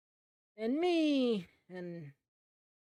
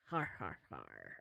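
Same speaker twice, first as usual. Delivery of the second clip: pirate laugh; 10 dB quieter than usual. Acted. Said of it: "He's like".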